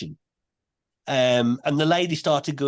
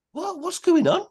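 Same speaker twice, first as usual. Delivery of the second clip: high-pitched startled question; retelling a shocked what; 12 semitones higher than usual. Retold or imitated. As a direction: blurt it out, completely thrown. Startled by voice in meditation.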